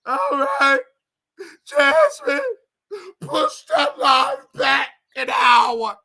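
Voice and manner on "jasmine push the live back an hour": high wailing fake sobs, slow and loud; mock fake crying. Mocking Tasha Cat begging Jasmine to delay the live, fake crying. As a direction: ham up crocodile tears. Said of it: "for the fake tears".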